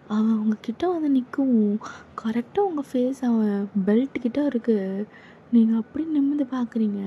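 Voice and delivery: ordinary talk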